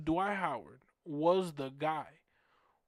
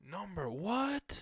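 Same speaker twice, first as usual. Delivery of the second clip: drawn-out, rising mock-baffled question; 3 semitones higher, slower than usual. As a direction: replay the confusion, stretching it slowly. Retold confused reaction, repeated.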